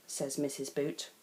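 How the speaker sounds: plain reading voice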